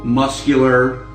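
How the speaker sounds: ordinary talk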